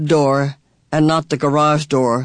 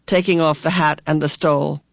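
ordinary delivery, nothing marked

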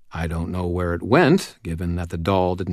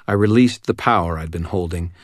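plain reading voice

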